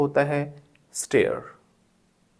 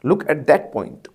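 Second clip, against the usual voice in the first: slow, louder stress on "that"; pointing look; 3 semitones higher, 6 dB louder, much slower than usual. Shown as a demonstration. Teacher directs the class to one point, slow and emphatic. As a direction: stretch "Look at that point", hitting "that". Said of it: "तो एक particular चीज़ को दिखाना".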